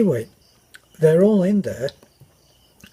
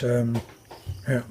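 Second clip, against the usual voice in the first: usual speaking voice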